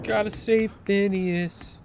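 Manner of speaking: high, slow, drawn-out call; mock-heroic call. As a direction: draw it out high, like a rallying cry. Calling out rescue goal mid game.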